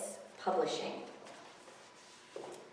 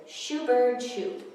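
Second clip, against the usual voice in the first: put-on shooing kid; 6 semitones higher, 5 dB louder, slower than usual. Kid waving off the gull.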